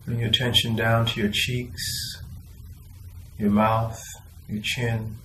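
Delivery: soft, unhurried body-scan voice with long pauses; guided meditation. Acted.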